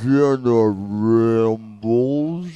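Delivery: drawn-out low announcer voice; slow sexy voice. Shown as a demonstration. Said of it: "Or you could just talk slow".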